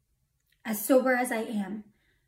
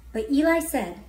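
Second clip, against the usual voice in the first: ordinary talk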